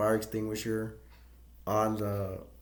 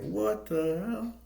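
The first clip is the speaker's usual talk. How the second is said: shocked outburst; 10 semitones higher, slower than usual. Retold or imitated. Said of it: "And the guy's like".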